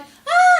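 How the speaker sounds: very high, loud mock shriek; imitated scream. Storyteller imitating the woman shouting back, screaming. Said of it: "the woman was shouting back".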